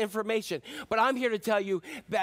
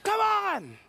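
coach urging; 10 semitones higher than usual. Retold or imitated. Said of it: "I'm like".

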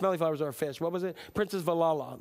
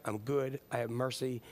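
softer, lower, more tender on each line; tender, softening; 7 semitones lower, 6 dB quieter than usual. Acted. Preacher softly lists who God is, fading tender. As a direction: let it fade gently into warmth.

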